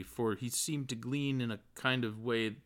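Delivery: plain reading voice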